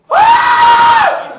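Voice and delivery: very high, loud, drawn-out shriek; comic high-pitched scream of alarm; panicked scream. Acted. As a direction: scream as a team charges at you. Team running towards him.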